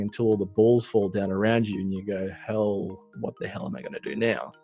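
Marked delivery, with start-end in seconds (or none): none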